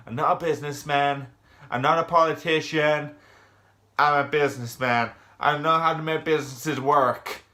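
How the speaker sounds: loud, hammed-up American accent; Donald Trump. Reviewer parodying the episode's Trump figure, loud American accent. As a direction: bluster it out in a bad American accent. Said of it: "That's my best American accent".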